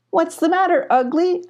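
high mocking question; teasing. A narrator's reading. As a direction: needle him with fake concern. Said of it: "asked Radar".

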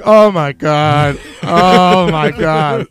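horrified oh my god. Retold or imitated. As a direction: drag out each word in disgusted horror. Hero hears slicing, looking away.

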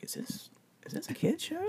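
high, quiet parent voice; worried parent voice. Retold or imitated. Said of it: "my parents were like".

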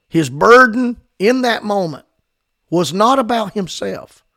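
fervent preaching. Real, not acted. Preaching at full force.

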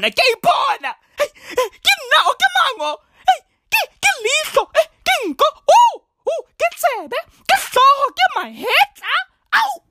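high, crying-out delight at seeing themselves; shrieking with wonder at their own reflection; overjoyed ancestors. Acted. Ancestors excitedly see themselves in mirror.

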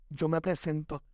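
usual speaking voice

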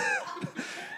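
a giggle at how silly it was; telling the congregation, laughing at his own scream; high breathy laugh. Real, not acted. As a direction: laugh high and airy at yourself. Laughing at own retold panic.

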